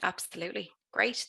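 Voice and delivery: usual speaking voice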